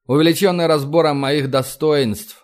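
ordinary delivery

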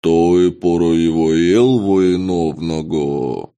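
slow, stretched, alien creature croak; drawn-out murloc voice. Acted. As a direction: drag every sound out like a fish-man. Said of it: "сильно растягивая звуки".

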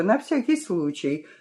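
usual speaking voice

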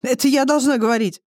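high-pitched indignant retort; snorting, huffy. A narrator's reading. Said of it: "фыркнула та". (возмущённо фыркая)

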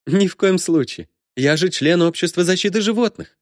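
high-pitched character voice, primly refusing; mock-righteous, high-voiced refusal; indignant character refusal. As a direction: refuse up high, as an animal protector would. Mock refusal in acted dialogue.

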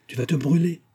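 high-pitched, mild caution; worried warning. A narrator's reading. Old nurse warning girl about boiling tea, worried. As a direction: warn her with rising concern.

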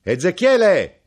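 slow, loud, high call; calling the name again, longer; drawn-out shout. Acted. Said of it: "gridò".

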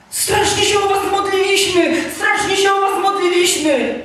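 very high, loud, overwrought imitation; frantic mock intercessor. Mimics frantic praying man's reply.

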